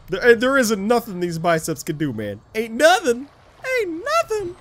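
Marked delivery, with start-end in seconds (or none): 2.6-3.3 higher-pitched, punchy boast; playful, raised-voice swagger; emphatic boast. Pretend. Repeats boast, changing the voice. 3.8-4.6 very high-pitched mock boast; playful falsetto swagger; silly high voice. Repeats boast in put-on voice.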